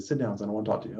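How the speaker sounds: barely above a murmur, tired but firm; weak quiet old man. Retold or imitated. Dying patient asks the nurse to sit, softly.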